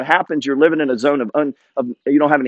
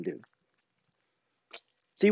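ordinary talk, nothing marked

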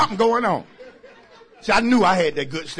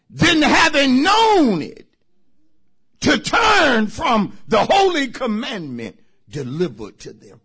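louder, higher, slow preached reading; scripture preaching; 8 semitones higher, 9 dB louder than usual. Acted. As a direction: proclaim the verse to the church.

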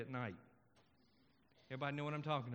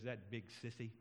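low, soft mutter; muttered aside; 6 semitones lower, 6 dB quieter than usual. Retold or imitated. Little boy remarks after parent leaves, low muttered aside. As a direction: drop it low and quiet, as an aside. Boy's aside about father, punchline.

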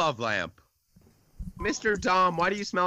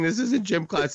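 usual speaking voice

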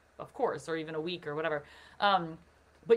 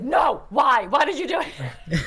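mock anguished protest; 4 semitones higher, 9 dB louder, slower than usual. [name] groaning at catchy song.

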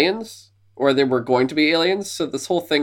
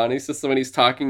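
ordinary delivery

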